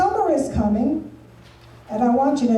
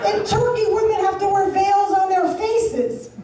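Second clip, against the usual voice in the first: ordinary talk, nothing marked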